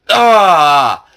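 loud high cry of dismay; startled yell. Real, not acted. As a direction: yell as you fall. Fails the parkour jump.

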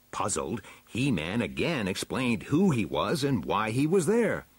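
plain reading voice

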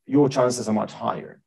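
normal talking voice

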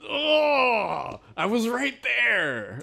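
imitated anguished wail. Retelling Max Payne slamming his hands, anguished wail. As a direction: wail it like you just missed. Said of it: "He's just like".

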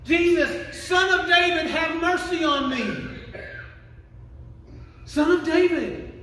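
high, loud acted holler to God; desperate yell. Beggar in desperate need hollers at Jesus, high loud yell. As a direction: holler slowly for attention, pitched high. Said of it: "yell loud enough that you need God".

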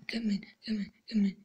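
soft unvoiced whisper. Real, not acted. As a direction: whisper it under your breath. Whispering at the slide top.

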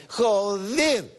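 "Joder" high, with a long stressed "-der"; accent on the E. Shown as a demonstration. Comic finishing the quoted denial, high stressed E in "Joder". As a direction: stretch and lift the end of "Joder". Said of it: "así con acento en la E".

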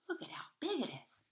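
high, soft and fast in awe; hushed aside. Real, not acted. Reader marvels to the child, hushed. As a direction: marvel quietly, quick.

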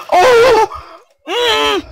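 very high, loud squeal; startled shriek. Real, not acted. Player hit by a witch's potion, shrieking.

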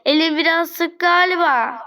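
normal talking voice

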